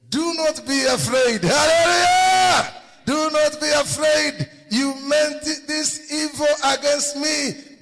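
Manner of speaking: very high, loud proclamation; full-force revival preaching, held high; exultant preacher's shout. Acted. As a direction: shout it to the rafters. Preacher exclaims Joseph's words, shouts hallelujah.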